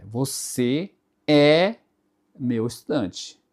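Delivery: "é" long and rising, open É; stretched accented é. Shown as a demonstration. Teacher shows accented E to students, drawn out and rising. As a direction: draw out "é" with a lifting tune. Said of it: "a letra E ... tiver o acento".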